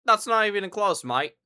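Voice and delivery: put-on playful Australian accent, mate at the end; a hint of Australian accent. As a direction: toss it off like a cheeky Aussie. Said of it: "That sounded more Australian".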